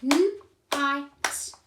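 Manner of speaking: a teacher segmenting "N-I-T" into three clapped sounds; sounding out night. Shown as a demonstration. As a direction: clap out each sound of night. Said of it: "Let's clap the word night".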